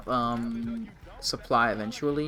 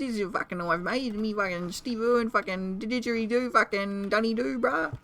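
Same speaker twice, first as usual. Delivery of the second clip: hammed-up Australian accent, higher and twangy; mock Australian accent; 6 semitones higher than usual. Streamer pretending to be the Australian mailman, in a put-on Australian accent. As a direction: play a cartoon Aussie bloke, Australian accent all through. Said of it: "I am Australian".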